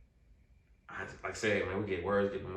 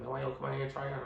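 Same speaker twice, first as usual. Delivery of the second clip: lightly voiced coach, higher and fast; quick, higher-pitched retold invitation; 7 semitones higher, much faster than usual. Coach asks him to come try out, lightly voiced coach. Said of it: "It's the coach said".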